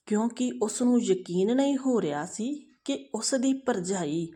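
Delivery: usual speaking voice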